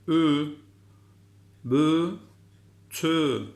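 "Ü, bü, tü" each loud and separate with rounded ü; read in sequence. Shown as a demonstration. Teacher reading syllables for class to repeat. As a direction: call out each syllable for repetition. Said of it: "seri bir şekilde okuyorum. Siz de tekrar edin".

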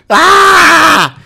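high loud scream; sudden scream. Real, not acted. Gamer screams as a monster attacks. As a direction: scream in fright.

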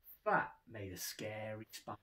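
plain reading voice

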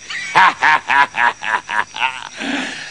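long, low villainous cackle; gloating farmer's evil laugh; wicked laugh. Acted. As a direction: cackle wickedly at your victim. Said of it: "laughing wickedly".

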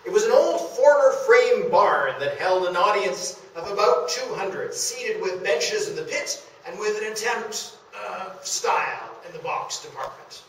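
lightly voiced old reporter's account; reading an old account. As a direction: read it as the old staffer recalling the barn. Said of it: "described the Royal as follows".

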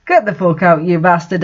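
ordinary delivery, nothing marked

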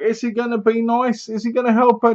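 high, sing-out questioning voice; mock hopeful wondering. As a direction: wonder aloud as a hopeful viewer. Voicing the viewer's hopeful suspense.

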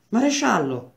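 richiamo gridato, tono alto; grido. A narrator's reading. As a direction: chiama il maresciallo gridando. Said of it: "gridò". (grido di richiamo acuto)

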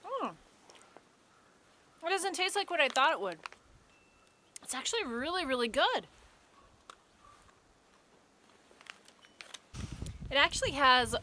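talking after a bite; chewing while talking. Real, not acted. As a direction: food in mouth. Seen 0: Bites bar before; holds it and chews while speaking, mouth partly closed.

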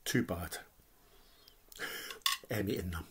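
whisper. Real, not acted. Cheeky aside about saving ribs.

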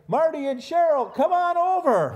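sing-song shout to friends; calling out. Pretend. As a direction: call them over across the yard. Calling couple over playfully.

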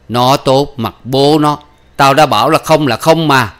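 cross father putting a foot down; angry refusal. Acted. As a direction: shut the argument down forcefully.

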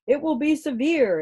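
radio announcer. A narrator's reading. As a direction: announce the forecast to the nation. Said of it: "said a voice".

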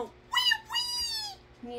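thin high mock wail; pretend lion crying. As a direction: squeal out a toy's little cry. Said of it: "Don't cry".

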